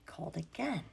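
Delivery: hushed whisper. Acted. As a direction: breathe it out in a whisper. Dramatic hush in repetition.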